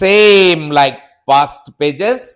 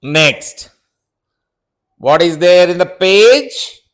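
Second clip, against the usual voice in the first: normal talking voice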